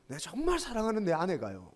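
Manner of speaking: ordinary talk, nothing marked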